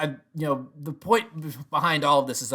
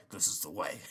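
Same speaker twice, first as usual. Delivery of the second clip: lower, quieter grudging mumble of the creed; grumbling creed repeat; 5 semitones lower, 9 dB quieter than usual. Retold or imitated. Paz crammed into the slot, grudgingly repeating the creed. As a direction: mutter it, resigned and squashed. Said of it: "It's like".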